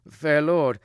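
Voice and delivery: urgent cry to a lord; pleading woodman. A narrator's reading. Second woodman pleading for life.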